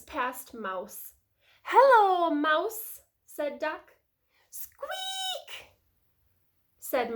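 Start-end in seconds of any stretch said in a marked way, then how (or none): 1.6-3.0 high, loud, drawn-out greeting; Duck calling hello. A narrator's reading. Duck greets Mouse in story. 4.5-5.7 tiny, very high, soft squeak; mouse squeak. A narrator's reading. Mouse noise in story.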